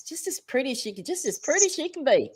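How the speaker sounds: a hint of a Southern accent. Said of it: "That was my Southern accent".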